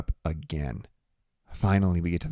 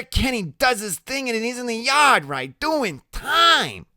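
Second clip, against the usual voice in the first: very high, excited gossip voice; Loretta, nosy; 4 dB louder than usual. Acted. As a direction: gossip away in a high character voice. Loretta reminiscing.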